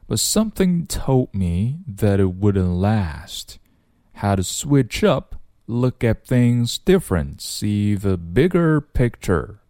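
slow, measured practice reading; unhurried, spoken version of rap lyrics; slow read-through. Shown as a demonstration. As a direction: read each phrase slowly for learners. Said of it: "我们先读一读啊".

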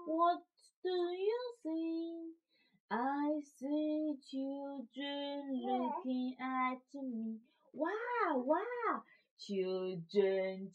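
normal talking voice

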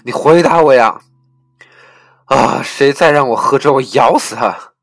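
full-force, high-pitched furious bellowing; enraged ranting that breaks into howls; crazed roar. A narrator's reading. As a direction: scream like a man driven mad. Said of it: "疯狂咆哮".

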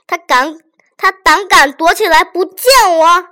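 loud, high outraged question; displeased outburst. A narrator's reading. Magistrate outraged that the old man hides from him. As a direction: bristle at being snubbed. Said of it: "满脸不高兴地说".

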